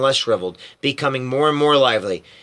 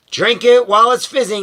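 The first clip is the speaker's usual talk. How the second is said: eager; 8 semitones higher, 5 dB louder than usual. Acted. Wonka urging a drink.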